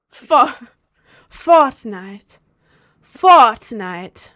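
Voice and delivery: loud mock-British "Fortnite" with dropped R; British accent, punched hard on the first syllable. Shown as a demonstration. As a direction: belt it out in a put-on British accent. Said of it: "British accent".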